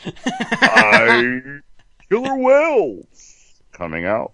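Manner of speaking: lightly voiced, slow theme-park announcer; mock a marine park show host, drawn out; a hint of a slow SeaWorld narrator, laughing; SeaWorld narrator impression. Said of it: "He sounds like the narrator at fucking SeaWorld".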